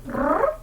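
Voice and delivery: high trilling cat purr. Retold or imitated.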